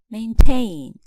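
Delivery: "maintain" with the stress on its last syllable; second-syllable stress. Shown as a demonstration. Teacher showing verb stress to learners, "maintain" stressed on second syllable. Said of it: "Verbs stress the second syllable".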